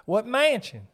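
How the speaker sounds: high-pitched baffled question; puzzled tourists. Acted. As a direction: act clueless, pitched well up. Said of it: "They're obviously".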